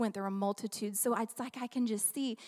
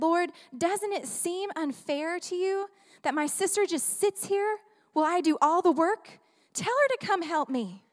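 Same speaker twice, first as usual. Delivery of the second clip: raised, aggrieved plea; higher indignant complaint to the Lord; Martha's complaint; 5 semitones higher, 4 dB louder than usual. Acted. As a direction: voice the put-upon sister griping. Martha complains to Jesus in reading.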